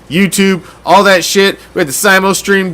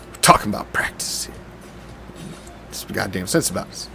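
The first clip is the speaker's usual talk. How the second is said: much softer, breathy aside; hushed practice line; 9 semitones lower, 13 dB quieter than usual. Acted. Echoing the famous practice rant, hushed aside. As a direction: drop to a hush on practice.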